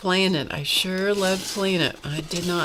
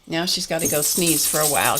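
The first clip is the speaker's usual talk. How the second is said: usual speaking voice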